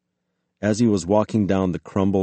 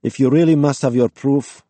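loud, higher-pitched impatience; exasperated challenge; 7 semitones higher, 7 dB louder than usual. A narrator's reading. Armed woman challenges the intruders, exasperated. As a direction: throw the challenge at them.

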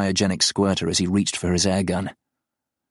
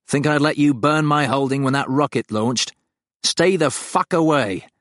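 raised, higher warning at the creature; gun-raised threat to the farm; angry threat; 7 semitones higher than usual. Acted. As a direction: back it off hard.

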